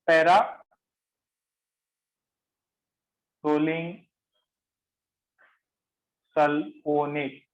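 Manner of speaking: very slow, long gaps between para, rolling and sulfonate; piece-by-piece dictation. Shown as a demonstration. Teacher giving the class a new compound name, dictated in pieces. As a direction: break the long chemical name into separate chunks.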